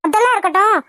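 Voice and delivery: ordinary talk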